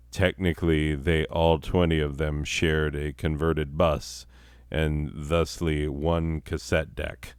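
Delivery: ordinary talk, nothing marked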